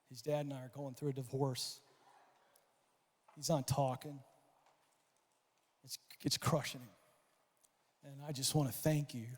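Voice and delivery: a mother near tears; tearful mom. Retold or imitated. Mother thanking the coach, understated tearful. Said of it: "she's crying".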